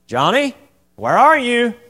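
high, loud, drawn-out call; mom calling out. Retold or imitated. Mother calling Johnny across the house, loud and high. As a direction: call out for a hiding child.

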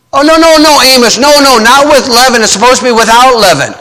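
high, quick mock protest; hammed-up horrified objection; mock alarm. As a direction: pretend to correct Amos in alarm.

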